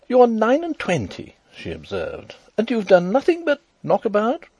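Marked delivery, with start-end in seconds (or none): 0.1-1.4 high, louder lady's voice; reproving sister-in-law. A narrator's reading. Sister-in-law rebukes idle Rudolf, high and emphatic. 2.6-4.5 high, louder lady's voice; reproving sister-in-law. A narrator's reading. Sister-in-law rebukes idle Rudolf, high and emphatic.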